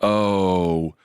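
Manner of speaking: long, slow-motion exclamation; drawn-out movie curse. Retold or imitated. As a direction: stretch each word like slow motion.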